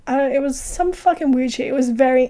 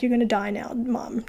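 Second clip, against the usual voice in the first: put-on creepy kid; 7 dB quieter than usual.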